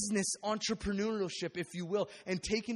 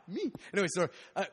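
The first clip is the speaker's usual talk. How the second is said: usual speaking voice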